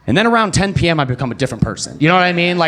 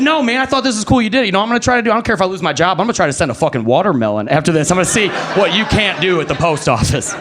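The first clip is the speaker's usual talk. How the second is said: excited; 6 semitones higher than usual. Retold or imitated. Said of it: "He was so excited".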